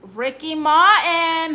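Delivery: loud, high, drawn-out name; big stretched-out shout of greeting; calling out. Real, not acted. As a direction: announce the name like a star's entrance. Greets a viewer by star's name.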